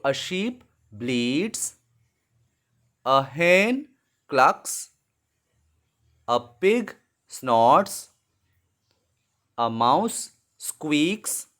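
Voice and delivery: very slow and deliberate; slow dictation. Shown as a demonstration. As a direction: spell it out slowly for students. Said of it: "putting the sounds in the blank".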